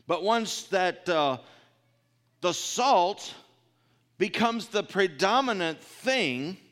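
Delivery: loud, high preaching voice. Acted. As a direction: preach it out to the congregation. Preaching at full force.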